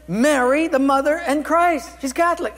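high, loud retold exclamation; astonished retort. Telling the congregation what she said, astonished.

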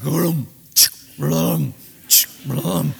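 low, loud booming march beat; marching drum sound. Acted. Preacher booming the marching steps of David's men. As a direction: boom out each footstep like a war drum.

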